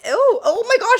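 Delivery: high, loud disgusted "ew"; squealing disgust; grossed out. Retold or imitated. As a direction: recoil at the thought. Disgusted reaction to idea.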